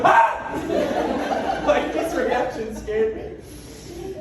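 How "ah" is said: startled yelp. Retold or imitated. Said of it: "I went".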